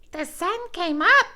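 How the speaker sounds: high, bright little squirrel voice; chirping squirrel. A narrator's reading. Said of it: "he chirped".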